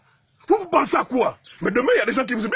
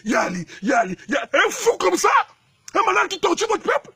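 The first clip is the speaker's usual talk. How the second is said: outraged shout; 7 semitones higher than usual. Real, not acted. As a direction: rail at the leader in disbelief. Mocking outrage at leader. (cri d'indignation)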